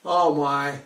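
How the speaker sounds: ordinary delivery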